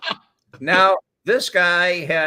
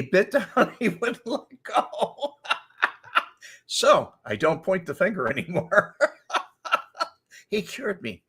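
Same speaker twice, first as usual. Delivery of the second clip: laughing; 4 semitones higher than usual. Real, not acted. Laughing through the story.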